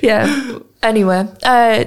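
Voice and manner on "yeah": laughing. Real, not acted. Laughs while talking.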